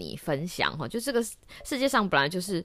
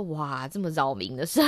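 normal talking voice

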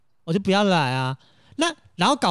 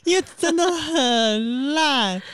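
high, slow, whining drawl; mock lament; 10 semitones higher, much slower than usual. Real, not acted. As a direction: moan it like a sad joke. Mock lament about bad episode.

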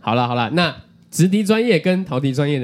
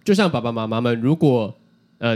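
ordinary delivery

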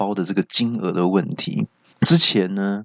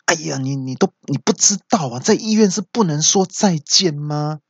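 mock scolding; 7 semitones higher than usual. Fellow patient scolds the friend.

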